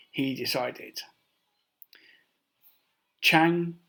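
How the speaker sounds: ordinary delivery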